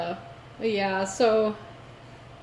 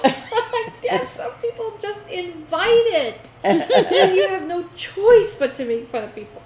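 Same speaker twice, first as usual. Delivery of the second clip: much higher, loud laughing protest; 12 semitones higher, 7 dB louder than usual. Real, not acted. Retelling her reply to dad, laughing hard. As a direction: laugh while arguing back. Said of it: "laughing retort to dad".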